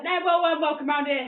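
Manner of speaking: imitated booming insistence; emphatic welcome. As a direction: proclaim the welcome loudly. Dad's loud welcome, acted.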